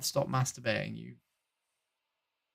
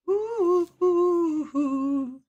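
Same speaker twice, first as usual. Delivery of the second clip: mock flirty coo; 13 semitones higher, 4 dB quieter, much slower than usual. Teasing coo at stranger.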